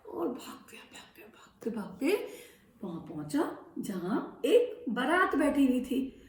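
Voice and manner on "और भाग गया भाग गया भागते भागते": soft whispered rush. A narrator's reading. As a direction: breathe it like a secret. Monkey runs off.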